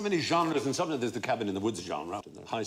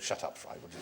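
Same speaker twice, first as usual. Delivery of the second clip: mock hushed; 9 dB quieter than usual. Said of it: "He goes".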